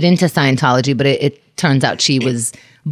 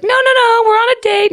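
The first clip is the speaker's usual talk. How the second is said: very high squeaky protest; hammed-up falsetto protest; mock medium squeal.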